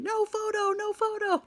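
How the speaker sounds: imitated guard's shout, high and loud; screaming guard. Security guards yelling at tourists in the chapel, mock screaming. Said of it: "guards are just screaming".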